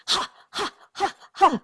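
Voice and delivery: high, soft chanted "ha, ha" breaths; goddess's high chant. Retold or imitated. Goddess showing a clearing dance, chanting high, soft ha sounds. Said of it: "She goes".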